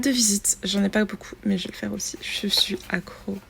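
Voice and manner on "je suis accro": soft slow whisper. Real, not acted. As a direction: whisper it like a confession. Same words whispered after plain take.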